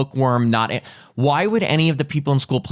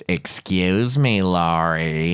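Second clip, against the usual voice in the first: low, slow, suggestive drawl that turns Muppet-like; pervy sheriff; 7 semitones lower, much slower than usual. Retold or imitated. Showing a co-host how the sheriff sounds, pervy Muppet-like voice. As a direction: lower the pitch and draw out the name.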